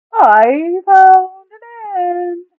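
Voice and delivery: very high, loud, slow bellow. A narrator's reading. Duke calling the others to his end of the turtle, full bellow. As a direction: bellow it as loud as you can. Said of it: "he bellows as loudly as he can".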